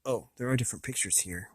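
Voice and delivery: ordinary talk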